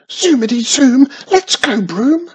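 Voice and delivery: high, loud squealing chant; squealed. A narrator's reading. Witch squealing at her broom to go. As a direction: squeal it with glee. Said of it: "she squealed".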